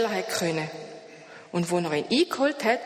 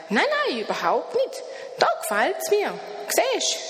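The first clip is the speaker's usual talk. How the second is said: much higher, bright little creature voice; high creature greeting; 10 semitones higher than usual. A narrator's reading. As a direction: greet him like a cheerful odd creature. Das Etwas spricht. (hohe Stimme des Wesens)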